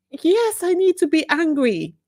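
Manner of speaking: high, emphatic comeback; defiant retort. Real, not acted. Speaker answers people who messaged her, defiant. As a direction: push back firmly, starting high on the yes.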